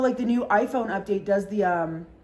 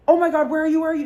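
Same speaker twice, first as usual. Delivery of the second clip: loud, raised urgent questioning; urgent and high, firing questions; frantic worry; 6 semitones higher, 7 dB louder, much faster than usual. Retold or imitated. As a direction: re-enact a panicked phone call. Retells frantic call to crying friend.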